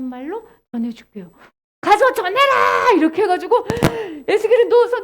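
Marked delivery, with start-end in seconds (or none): 1.8-2.9 loud, high, stretched-out order; commanding. Acted. God ordering Ezekiel to go, loud high command. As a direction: ring out the command slowly.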